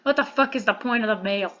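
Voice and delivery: ordinary delivery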